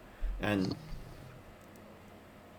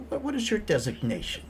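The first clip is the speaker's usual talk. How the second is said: startled high voice; 12 semitones higher than usual. Acted.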